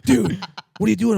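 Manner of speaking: high, loud panicked demand; imitating rattled buddy. Spooked buddy phoning his prankster friend, imitated panic.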